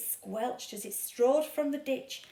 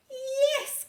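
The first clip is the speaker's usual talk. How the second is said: high bright cry of yes; cried yes; 6 dB louder than usual. A narrator's reading. Witch welcomes bird aboard, high cry. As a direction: witch gladly agrees, high and loud. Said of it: "cried the witch".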